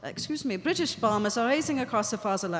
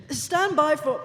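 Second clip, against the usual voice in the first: louder, higher strained call; choking German radio announcer; struggling to announce; 4 semitones higher, 5 dB louder, slower than usual. Acted. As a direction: force it out between coughs. Announcer overcome by coughing on air.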